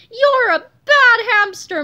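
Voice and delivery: put-on loud, high, drawn-out childish protest; upset cry. A narrator's reading. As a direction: wail it out like an upset boy. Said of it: "Melvin cried".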